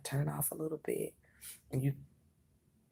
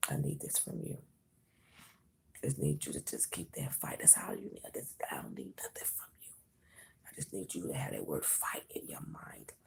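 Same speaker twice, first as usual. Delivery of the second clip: hushed whisper; 4 dB louder than usual. Real, not acted. Whispers the reading.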